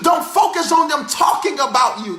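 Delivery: rhythmic preacher cadence, shouted peaks; full-force preaching. Acted. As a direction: preach it like an old Baptist preacher. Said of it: "I felt the old Baptist preacher rise up in me".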